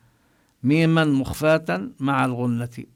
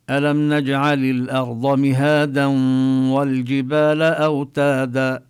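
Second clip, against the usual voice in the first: Quran recitation; 4 dB louder than usual. Shown as a demonstration. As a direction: recite it with nasal ghunna. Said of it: "مثل". (تلاوة مجودة بغنة)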